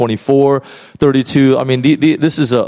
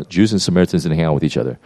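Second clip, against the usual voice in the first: pastoral voice; 7 semitones lower, 3 dB quieter, much faster than usual. Retold or imitated. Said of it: "again in his pastoral voice".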